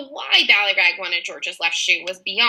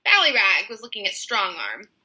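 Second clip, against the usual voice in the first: usual speaking voice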